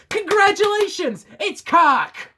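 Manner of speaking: high, bright-voiced congratulations; mock gameshow host. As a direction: announce it like a cheesy host.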